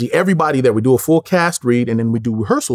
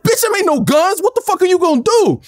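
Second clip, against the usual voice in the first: high-pitched scornful demand; acting out an angry confrontation; heated challenge. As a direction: dare him, voice pitched way up. Acts out rival's angry challenge.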